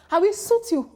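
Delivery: put-on high-pitched take on an actor's local accent; laughing mimicry of a Yoruba movie accent; mimicked local accent. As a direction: imitate his thick local accent. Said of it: "you have an accent".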